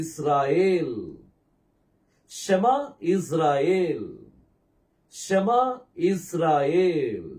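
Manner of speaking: ஷமா இஸ்ராயேல் very slow, long vowels held; exaggerated pronunciation. Shown as a demonstration. Teacher modelling the phrase for students, exaggerated pronunciation. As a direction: draw out each syllable of ஷமா இஸ்ராயேல். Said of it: "நான் அதை அதிகமா தான் உச்சரிக்கிறேன்".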